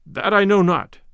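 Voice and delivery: mildly surly narrated reply; understated grumpy refusal. A narrator's reading. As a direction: answer with a touch of sullenness. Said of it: "quoth the stranger surlily".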